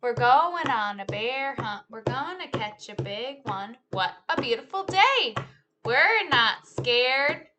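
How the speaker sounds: lively, sing-song storytime read; animated picture-book telling; reading to children. Acted. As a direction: act out the story for a class of kids. Teacher retells picture book with moves.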